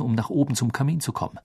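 plain reading voice

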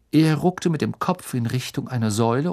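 usual speaking voice

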